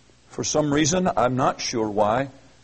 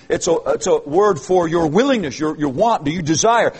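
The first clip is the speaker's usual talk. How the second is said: ordinary delivery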